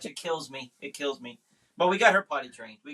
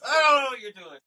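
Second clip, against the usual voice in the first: laughing through it, pitch up; half-laughing, half-pleading; 8 semitones higher, 5 dB louder than usual. Real, not acted. Dad laughing about his daughter skipping hugs, laughing.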